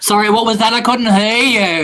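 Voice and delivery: loud teasing shout; mock shout. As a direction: bellow it comically.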